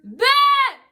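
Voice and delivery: high, loud one-syllable mock shout; imitated girl's yell. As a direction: imitate a woman yelling from a passing car. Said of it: "from a girl going".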